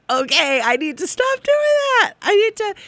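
jumps way up high for the self-talk; high mock inner voice. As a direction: squeak out the resolution to yourself in a high voice. Self-talk quote of resolve.

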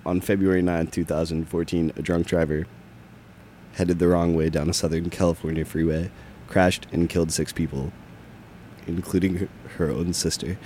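low, measured public service announcement; serious low radio announcer; solemn PSA read. Acted. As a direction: read a grave news item in a low, even voice.

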